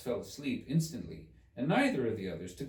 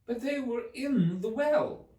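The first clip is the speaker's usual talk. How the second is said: put-on high, slow girlish question; a hint of a puzzled girl; lightly voiced Alice; 5 dB louder, slower than usual.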